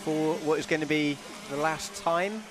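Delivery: excited play-by-play call; race commentary. Acted. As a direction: call the finish line. Live race commentary over footage.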